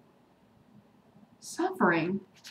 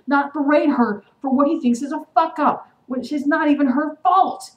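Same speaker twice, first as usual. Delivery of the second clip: heated, rising indignation; getting angry; 8 semitones higher, 7 dB louder than usual. Real, not acted. Vlogger defending Elena against Stefan's berating, getting angry. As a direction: get louder and higher, driving each point home. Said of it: "I'm sorry that I'm getting angry".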